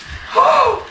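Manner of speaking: sharp gasp at icy water; cold gasp. Real, not acted. Jumping into ice water.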